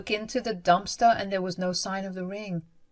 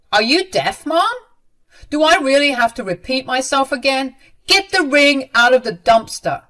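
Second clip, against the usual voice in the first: loud, raised-pitch angry demand; exasperated order; 7 semitones higher, 9 dB louder than usual. A narrator's reading. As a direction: snap impatiently at mother. Son loses patience, repeats order.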